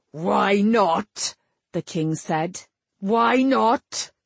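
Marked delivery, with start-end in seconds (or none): 0.1-1.3 angry, incredulous challenge; indignant king. A narrator's reading. Furious king repeats question. 3.0-4.1 higher, angrier incredulous question; raging repeat. A narrator's reading. Furious king repeats question, rising.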